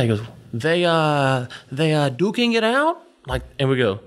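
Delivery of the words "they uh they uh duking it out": lightly voiced owner, slow and higher; slow question climbing high at the end; imitated motel owner. Motel owner checking on a fight, lightly imitated slow, high query. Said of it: "he walks up to us and he goes".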